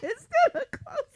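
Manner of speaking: ordinary talk, nothing marked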